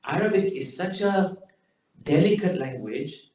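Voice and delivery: normal talking voice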